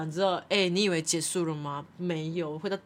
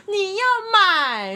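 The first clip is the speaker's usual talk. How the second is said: loud, high, drawn-out mock yell; hammed-up outraged coworker; imitating angry coworker; 13 semitones higher, 9 dB louder, much slower than usual. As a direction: stretch it into an exasperated shout. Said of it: "然后就同事就会生气".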